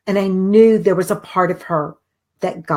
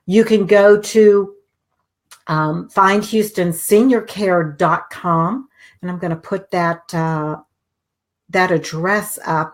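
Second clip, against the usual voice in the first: ordinary talk, nothing marked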